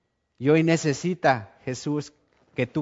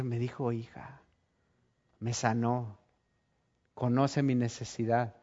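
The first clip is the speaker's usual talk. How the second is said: much softer, hushed, peaceful; tender awe; 4 semitones lower, 9 dB quieter than usual. Acted. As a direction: murmur it in quiet wonder. Woman's peaceful inner words.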